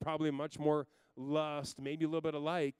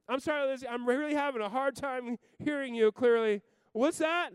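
mock disbelief; 10 semitones higher, 7 dB louder than usual. Dad pretends not to hear.

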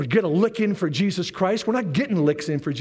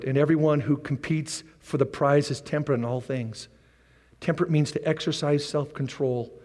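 ordinary delivery

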